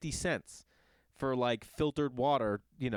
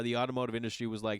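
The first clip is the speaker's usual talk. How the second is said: usual speaking voice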